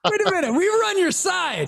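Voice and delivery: high-pitched incredulous objection; mock betrayed protest. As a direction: plead your case in comic disbelief. Acting out fans feeling betrayed.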